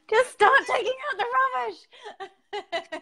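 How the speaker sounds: words squeezed through high laughter; cracking up mid-joke; laughing. Real, not acted. Joking, laughing while speaking.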